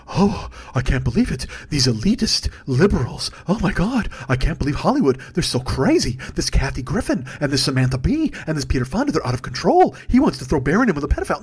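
low, put-on scandalised voice; hammed-up pearl-clutching; mock shocked public. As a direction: mimic a horrified prude. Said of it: "Everybody gets to go".

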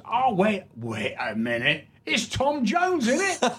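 high Cockney double-take; hammed-up Cockney, soaring on the recognition. Cockney fan mistakes actor for [name], excited high Cockney. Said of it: "this Cockney comes in".

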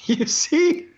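high, strained, choked-up voice; real emotion pushing the voice high. Real, not acted. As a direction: fight back tears while telling it. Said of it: "I'm getting choked up right now".

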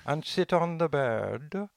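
a hint of a calm teacher's accent; imitating Eckhart Tolle. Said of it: "With that, uh, accent in there".

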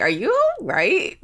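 high, slow mock concern; retold bewilderment. Panelist recalls reacting to his odd noise, mock bewildered. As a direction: draw it out high, as if puzzled. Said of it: "was looking at him like".

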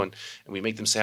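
normal talking voice

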